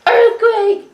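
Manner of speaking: high, loud retold squealing; excited screaming. Reenacting roommates screaming at each other, thrilled. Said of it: "screaming at each other".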